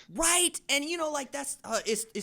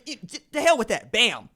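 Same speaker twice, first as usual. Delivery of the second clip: normal talking voice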